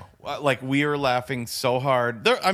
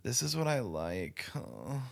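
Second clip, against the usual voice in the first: vocal fry; 5 dB quieter than usual. Shown as a demonstration. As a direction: drag the words out in a lazy creak. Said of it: "Just, just glottal fry".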